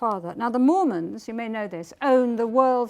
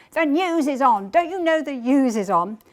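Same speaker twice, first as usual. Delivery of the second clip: irritated; 3 semitones higher, 6 dB louder than usual. Retold or imitated. Father annoyed at phone interruption.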